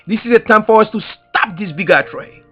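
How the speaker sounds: ordinary talk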